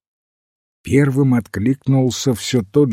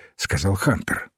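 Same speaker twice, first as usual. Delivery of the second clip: usual speaking voice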